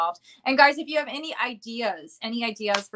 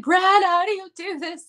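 high, louder mock wail down the phone; mock crying; 10 semitones higher, 4 dB louder than usual. Actress venting to her friend Brad about bad auditions, mock crying. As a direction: imitate a distraught phone call, pitched high. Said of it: "so many crying phone calls".

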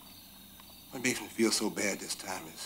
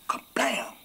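sudden loud, high burst; imitated door slam; 8 dB louder than usual. Man retelling the gust slamming the door, explosive.

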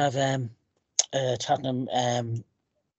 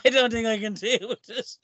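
higher voice breaking into laughter; laughing; 7 semitones higher than usual. Real, not acted. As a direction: talk through real laughter, pitch riding up. Joking about stressful games amid laughter.

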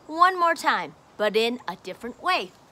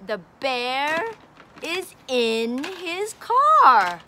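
slow, louder, stressing car; slow teaching read; 4 dB louder, slower than usual. Shown as a demonstration. Teacher reading to child, slow and emphatic. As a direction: spell it out slowly for the child.

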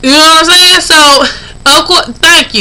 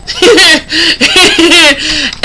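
short laugh at her own joke; amused laugh; 2 semitones higher than usual. Real, not acted. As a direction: laugh it off, pleased with herself.

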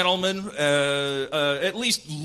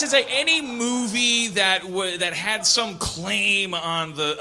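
usual speaking voice

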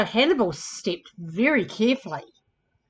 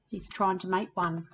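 plain reading voice

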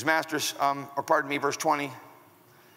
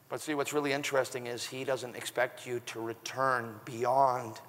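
normal talking voice

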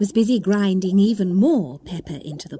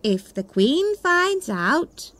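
1 gardener; 5 semitones higher, slower than usual. Acted. Gardener card voiced.

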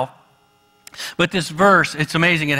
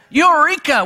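excited discovery; 9 semitones higher, 4 dB louder than usual. Retold or imitated. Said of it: "is like".

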